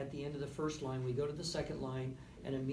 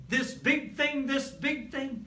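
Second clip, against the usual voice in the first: loud and higher, broad; big forte; 11 semitones higher, 8 dB louder, slower than usual. Shown as a demonstration. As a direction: show the loud passage big. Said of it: "this really exciting thing".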